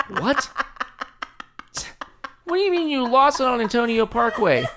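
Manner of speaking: mock the manager's disbelief through laughter; incredulous manager. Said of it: "manager's incredulous reply".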